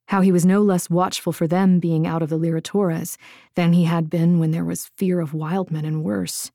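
ordinary delivery, nothing marked

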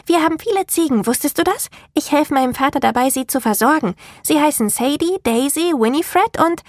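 put-on high, quick, chattering little girl; bright and lively. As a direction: bubble over with cheerful chatter. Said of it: "so aufgeweckt wie zuvor".

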